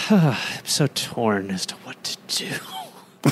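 slow breathy whisper, torn; sighing whisper. Pretend. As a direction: sigh and whisper it slowly. Sighing, torn drunk guest.

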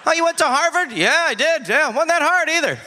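high, breezy boast; smug fake graduate. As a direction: brag about a bought degree lightly. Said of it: "faked Harvard degree holder".

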